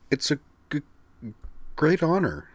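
high, halting stammer; stuttering shy girl. Acted. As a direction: stumble nervously over each word. Kate voiced with a stutter.

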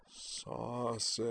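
drowsy soft moaning word. Acted. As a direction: moan it softly, drifting toward the smell. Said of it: "moaning softly".

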